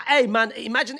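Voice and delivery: ordinary talk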